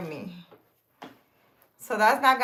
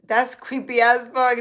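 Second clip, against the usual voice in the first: ordinary talk, nothing marked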